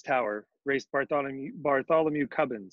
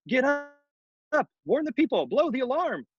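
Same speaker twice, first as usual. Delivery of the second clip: high-pitched acted cry of alarm; story character raising the alarm; urgent rousing call; 10 semitones higher than usual. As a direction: call out to wake a sleeper. Boy rousing sleeping trumpeter urgently.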